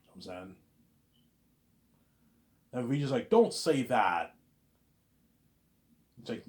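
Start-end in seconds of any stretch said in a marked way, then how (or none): 3.3-4.3 slow pleading. Retold or imitated. Vijay line acted.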